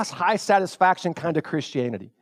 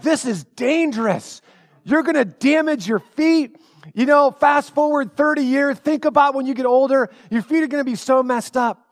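mom scolding; 7 semitones higher than usual. Retold or imitated. Mom scolding about shoes.

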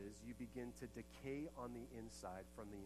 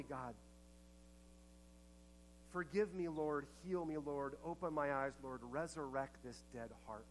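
heartfelt prayer; 3 semitones higher than usual. Acted. Leads congregation in a prayer.

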